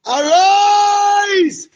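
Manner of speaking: long, very high, loud cry of Arise; shouted summons. Real, not acted. Worshipper calling Jehovah to arise, soaring shout.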